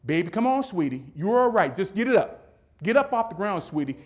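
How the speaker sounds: high, loud imitation of a coaxing mother; mother cheering son on. Mother urging her fallen son from afar, imitated loud coaxing. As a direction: call out encouragement as an imitated mom. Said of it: "what I heard was".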